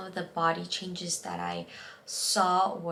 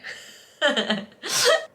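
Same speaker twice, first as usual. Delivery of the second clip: teary laugh; 8 semitones higher, 8 dB louder than usual. Real, not acted. Laugh after voice shakes on air.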